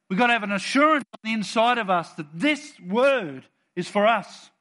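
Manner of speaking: ordinary delivery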